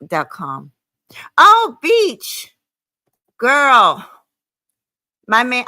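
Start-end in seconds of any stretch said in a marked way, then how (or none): 1.4-2.6 high, loud sing-song "Oh, Beach" callout; drawn-out bitch greeting. Acted. Vlogger greeting her viewers with a loud catchphrase. 3.4-4.1 put-on big loud "Girl" with a falling slide; loud drawn-out girl. Vlogger calling to her audience, loud and drawn out. Catchphrase callout.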